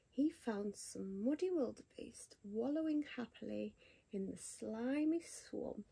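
plain reading voice

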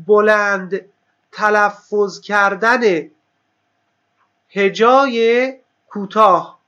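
slow, word-by-word delivery with pauses; slow dictation. Real, not acted. Teacher repeating the term's name for students, slow dictation. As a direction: spell out the term at dictation pace.

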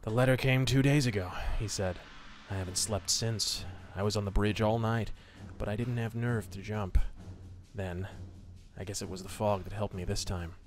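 steady, low storytelling voice; story narration. A narrator's reading. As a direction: read it like an old pulp radio tale. Reading a pulp story aloud.

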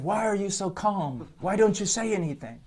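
imitating an angry officer; mock angry demand. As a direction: retell the screaming policeman, pressing and high. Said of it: "He's screaming at me".

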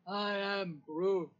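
slow, drawn-out Groot, falling at the end; Groot impression. Shown as a demonstration. Assistant doing another requested impression, slow declarative Groot. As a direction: state it slowly as Groot. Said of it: "Do another impression".

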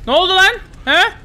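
high, loud startled cry; loud surprised yelp; startled shout. Real, not acted. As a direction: blurt it out in shock. Startled outburst.

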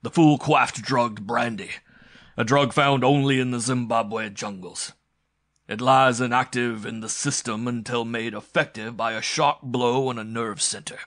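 loud, emphatic boasting; gloating villain. Acted. Villain explaining the drug to his captive, gloating. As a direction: declaim it loudly, pleased with the scheme. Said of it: "laughed the Octoroon".

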